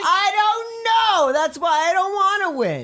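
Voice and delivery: high, loud whiny protest; whiny shout. Acted. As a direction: shout it like a sulking kid. Mock outrage at winning.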